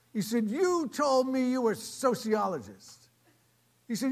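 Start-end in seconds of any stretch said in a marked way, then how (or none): 0.5-3.1 high, loud, drawn-out accusation; accusing challenge. Retold or imitated. Diner owner accusing the man who prayed, indignant. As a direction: call him out slowly and forcefully.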